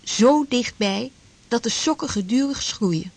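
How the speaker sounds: normal talking voice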